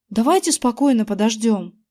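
ordinary delivery, nothing marked

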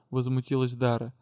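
plain reading voice

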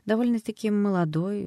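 usual speaking voice

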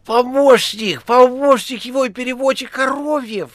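high, loud, rattling introduction; crackling phone chatterbox. A narrator's reading. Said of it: "трещала трубка". (трескучий высокий громкий голос)

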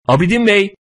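high-pitched call from behind. A narrator's reading. Man calls after a runner he takes for Abidin, calling out. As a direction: hail someone running away. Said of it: "Arkamdan biri bağırıyor".

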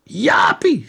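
high, loud call to the TV; shout. Acted. Father shouting at the screen for his son, loud. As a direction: shout the boy's name. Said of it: "schreeuwde hij".

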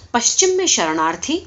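ordinary delivery, nothing marked

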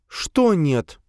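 high, feigned puzzlement; Sofia's voice. A narrator's reading. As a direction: play innocent, pitched high. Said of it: "Она сделала вид, будто не поняла". (высоким голосом, изображая непонимание)

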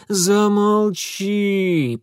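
very slow moaned command; groaning. Acted. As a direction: groan it out in pain. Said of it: "простонал Митя".

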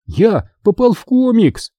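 high, slow excited shout. A narrator's reading. Boy bursts into the kitchen to parents, high excited shout. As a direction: burst in yelling the news. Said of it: "крикнул я".

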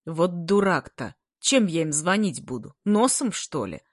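ordinary delivery

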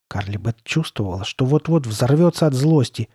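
ordinary delivery

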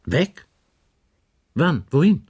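the inspector pounces, startled and pressing; startled questions. Acted. Inspector taken aback by the news, firing startled questions.

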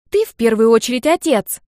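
loud, firm declaration; child scolding like a grown-up; grown-up firmness. A narrator's reading. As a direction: lay it down like an adult. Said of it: "по-взрослому заявила сестра".

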